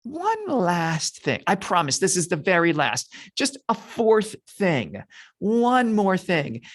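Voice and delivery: sheepish, hammed-up bargaining; wheedling stalling. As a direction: stretch out the key phrases to buy time. Moses stalling, pleading for another question.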